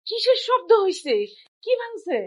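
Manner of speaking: high-pitched alarmed call; startled questioning. Acted. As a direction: ask in alarm what just broke. Wife startled by breaking glass. (চমকে উঠে চড়া গলায় প্রশ্ন)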